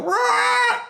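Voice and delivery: high yelped raptor shriek, half-hearted; mock the velociraptor cry, way up high; imitated raptor screech. Reviewer mimicking the raptors' cry, a high mock screech. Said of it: "That's not the sound they make".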